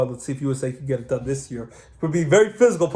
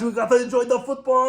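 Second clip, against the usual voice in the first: high Austrian action-hero voice; Arnold Schwarzenegger impression; 7 semitones higher than usual. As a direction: keep the Austrian muscleman going. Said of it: "Arnold Schwarzenegger, he has taken over".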